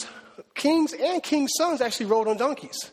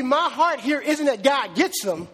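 ordinary talk

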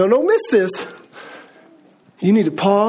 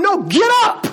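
firm, higher-pitched push to get moving; urging command; 9 semitones higher than usual. Acted. Preacher spurring on people who need invigorating, urging. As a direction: spur them on with a raised call.

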